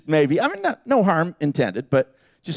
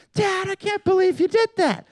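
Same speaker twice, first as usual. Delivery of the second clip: high-pitched mock kid voice; scolding child; 13 semitones higher than usual. Child scolding dad after the prank, high mock voice. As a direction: imitate an outraged kid in a high voice.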